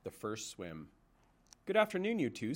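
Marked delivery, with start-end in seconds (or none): 1.6-2.5 a high, chirpy goose voice lightly put on; old goose greeting. Old goose greeting Roz in the garden, cheerful. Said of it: "said Loudwing as she waddled".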